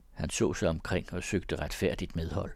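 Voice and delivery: usual speaking voice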